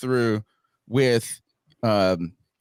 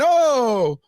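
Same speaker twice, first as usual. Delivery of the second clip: a lightly higher, dismissive don't worry; mock reassurer; 6 semitones higher than usual. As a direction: brush it off mockingly. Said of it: "you got people going".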